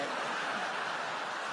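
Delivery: voiceless wheezing laugh; breathless laugh. Real, not acted. Comic laughing at his own tissue prank, breathless wheeze.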